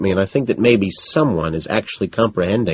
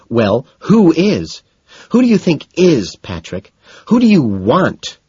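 loud, higher, slow demanding questions; 8 semitones higher, 5 dB louder, slower than usual. A narrator's reading. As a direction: hurl each question at him. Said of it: "she demands".

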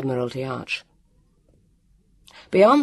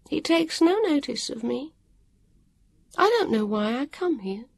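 higher, resigned tone; a touch of bitterness; dour weary woman; 12 semitones higher than usual. A narrator's reading. Woman sitting down, resigned about the watcher.

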